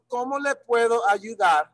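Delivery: ordinary talk, nothing marked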